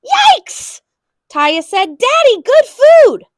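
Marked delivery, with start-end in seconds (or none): 0.0-0.8 high, loud shocked yelp; father yelping at the full cart; startled yell. A narrator's reading. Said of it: "Her father turned around and yelled". 2.0-3.2 high, loud, slow and pleading; child voice begging her father; eager child plea. A narrator's reading.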